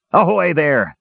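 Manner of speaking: high, irritable hail; irritable roar. A narrator's reading. Dwarf captain hailing meddling creatures, irritable roar. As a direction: call out crossly to the crew. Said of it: "Dugan roared irritably".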